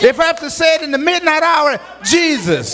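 high, fast rhythmic preacher's build; chanted sermon climax on the name; preaching at full force. Acted. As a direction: drive each line up, land on Jesus. Preaching crescendo on Jesus.

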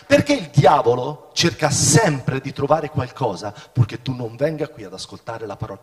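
ordinary talk

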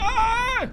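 short, high-pitched shriek; startled scream. Real, not acted. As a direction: scream in sudden fright. Startled during the attack.

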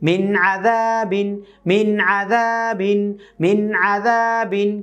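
slow "মিন আযাবিন" with a clear unhummed n in মিন; clear, no ghunnah. Shown as a demonstration. Teacher modelling for learners, "মিন আযাবিন" slow and clear without ghunnah. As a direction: recite "মিন আযাবিন" slowly, long vowels, first n not held. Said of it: "সুন্দর করে".

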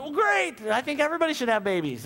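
high, bright burst of cheer; sudden high mock delight; mock enthusiasm. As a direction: gush like it's great news. Pastor retells his enthusiastic reply.

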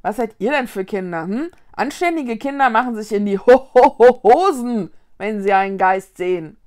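loud scolding with stuttered spooky emphasis; indignant ghost. Acted. Ghost scolds children for showing no fear, indignant.

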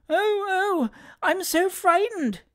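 put-on very high, slow little-boy wail; frightened child. A narrator's reading. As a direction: whimper it out like a scared child. Terrified small boy wails.

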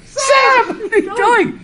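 high, loud laughing shout at Sam; teacher shouting at a character in the book; excited outcry. Real, not acted. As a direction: cry out in amused disbelief. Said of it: "exclaims at Sam, laughing".

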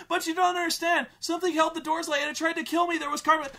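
put-on high, loud, fast frantic teen; breathless panic, words tumbling out; panicked girl. Girl running screaming to her dad, high frantic panic. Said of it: "she's like".

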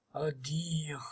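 breathless whispered oddio; awed whisper. Real, not acted. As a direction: whisper it in disbelief. Stunned by the pack.

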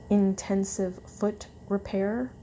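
ordinary delivery

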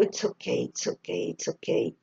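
hushed English whisper. Retold or imitated. Quoting the singer comforting herself, whispered. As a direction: whisper it to calm yourself. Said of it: "esta chica diciendo".

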